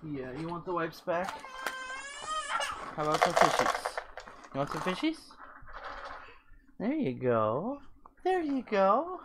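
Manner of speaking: high cooing pet voice. Real, not acted. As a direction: coo lovingly at the pet.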